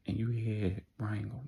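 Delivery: usual speaking voice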